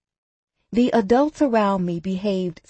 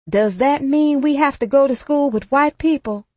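high, louder worried question; a schoolboy blurting it out; boy's question; 7 semitones higher, 4 dB louder than usual. A narrator's reading. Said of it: "my friend Carl asked".

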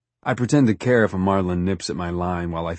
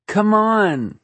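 much higher, louder coaxing call; excited calling out; 13 semitones higher, 5 dB louder than usual. A narrator's reading. Love urges him into the water, excited calling out. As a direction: call playfully across the water, high-pitched.

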